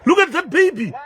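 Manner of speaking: high, loud shocked shout with a laugh; stunned outburst, pitched high and loud; shocked cry. Real, not acted. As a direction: cry out in disbelief at the sight. Shocked at baby collapsing.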